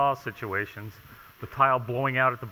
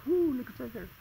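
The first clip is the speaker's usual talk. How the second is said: much higher, soft surprised ooh; 12 semitones higher, 4 dB quieter than usual. Real, not acted. Inspector finding a hidden gap, softly surprised. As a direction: let the discovery pop out high. Spotting a gap behind tile.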